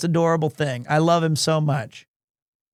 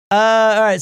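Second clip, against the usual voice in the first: normal talking voice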